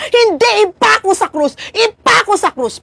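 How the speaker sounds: high insistent mock shout of the mob; crowd shouting. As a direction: echo the crowd's second demand. (ginagayang sigaw ng madla)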